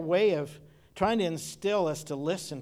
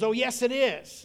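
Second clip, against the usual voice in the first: grandma insisting; 7 semitones higher, 5 dB louder than usual. Retold or imitated. Grandmother insisting in quoted argument.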